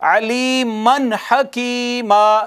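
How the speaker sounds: अलीमन हकीमा slowly, नून sounded before हा; slow recitation. Shown as a demonstration. Teacher modelling the correct reading. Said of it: "नहीं कहना".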